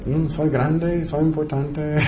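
slow, soft, self-satisfied drawl; smug self-importance. Acted. Preacher mocks a comfortable David's imagined psalm, smug and slow. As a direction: purr it like someone pleased with himself. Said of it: "Él podía tener".

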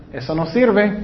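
ordinary delivery

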